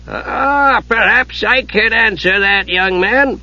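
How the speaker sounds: put-on high, drawn-out old man's voice; old wizard. A narrator's reading. Old wizard offers Palin an answer, high elderly voice. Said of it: "An old wizard".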